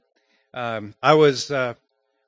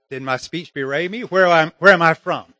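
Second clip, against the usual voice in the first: ordinary talk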